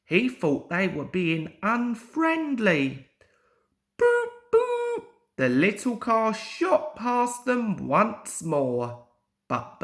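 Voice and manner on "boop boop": very high, bright two-note toot; car horn beep. A narrator's reading.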